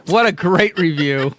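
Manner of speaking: sarcastic praise through laughter; mock praise. Real, not acted. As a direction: laugh at your own useless review. Sarcastic praise of failed guessing.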